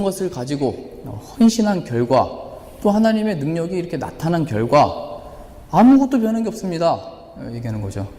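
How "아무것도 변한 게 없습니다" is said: exasperated outcry. Acted. Preacher voices Elijah's despair.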